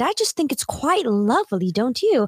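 hammed-up English accent. Shown as a demonstration. Friend answering which accents they can do, playful English accent. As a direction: put on a polite English accent. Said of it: "doing the English accent".

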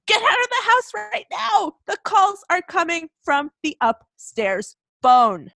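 high-pitched urgent yell, slowing toward the end. A narrator's reading. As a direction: cry out a hammed-up panicked warning. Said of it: "The operator from the police station who yelled".

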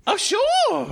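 put-on very high, girlish voice; high-pitched schoolgirl. Harriet agrees to watch Carrot, high and girlish. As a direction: agree in a high, sweet squeak.

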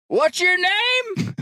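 put-on slow, high drunken shout across the bar; slurring mock drunk yell; drunk man yelling. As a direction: holler it like a wobbly drunk. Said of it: "he is drunk. And he's wobbly".

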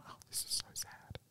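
whispered. Real, not acted. Moved by sad story.

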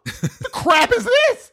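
slow, high-pitched indignant cry; mock mad. Host mad at Netflix showing a new show, mock indignant cry. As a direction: draw it out in hammed-up outrage. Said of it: "I'm just mad that this random commercial".

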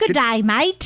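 light Australian greeting; imitation Australian twang; Australian accent. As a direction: try a breezy Aussie hello.

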